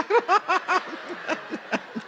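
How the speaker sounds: breathless high laugh. Real, not acted. Laughs at his own story.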